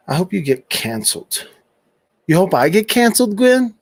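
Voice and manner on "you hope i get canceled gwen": thrown back higher and louder, incredulous; mocking echo. Real, not acted. Streamer echoing a troll's comment back at her, mocking. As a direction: toss her words back at her, amused.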